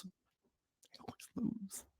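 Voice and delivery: slow, defeated whisper; weary whisper. Real, not acted. Host sighing over another Rutgers loss, slow whisper. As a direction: breathe it out like there's no hope left. Resigned verdict on Rutgers losing.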